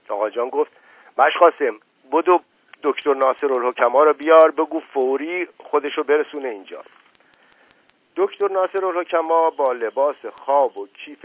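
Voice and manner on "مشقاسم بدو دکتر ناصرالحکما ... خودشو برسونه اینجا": louder call to the servant; urgent order. Acted. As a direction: call him over, urgent and loud. Father orders servant to run. (دستور فوری با صدای بلند)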